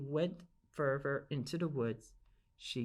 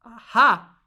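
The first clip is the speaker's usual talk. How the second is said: plain reading voice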